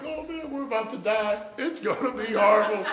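high, giggling mock panic; giggling dread. Two kids fleeing the bathroom, high giggling mock dread. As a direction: laugh through the fake doom. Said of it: "we were giggling and laughing".

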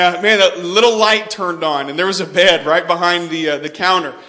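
usual speaking voice

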